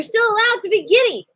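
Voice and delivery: loud, high, upbeat burst; bright-pitched, excited permission; giddy encouragement. Real, not acted. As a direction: cheer them on, high and loud. Cheering class after calm reassurance.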